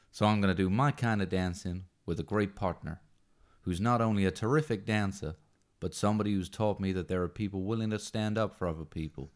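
put-on deliberately poor Yankee Doodle American accent; bad American accent. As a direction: read the movie speech in your worst American accent. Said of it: "words in my worst American accent".

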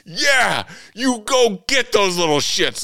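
high, loud, slow cheering shout. Retold or imitated. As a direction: cheer him on like a fan. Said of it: "laughing and cheering".

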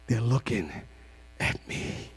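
low, fading to a whisper; hushed. Acted. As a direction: breathe out the last words. Son's imagined thought, hushed.

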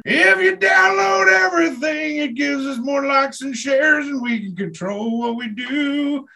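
high, slow announcer pitch; promo read. Acted. Host reading a plug to listeners, announcer style. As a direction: sell it like a station promo.

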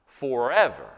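"forever" punched after a pause, then falling; stress on FOREVER. Real, not acted. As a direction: hit "forever" firmly on its own. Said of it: "put emphasis on the words forever".

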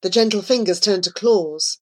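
plain reading voice

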